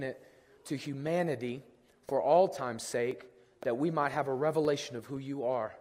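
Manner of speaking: soft, reverent prayer voice; opening prayer. Acted. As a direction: pray gently over the congregation. Pastor leads opening prayer.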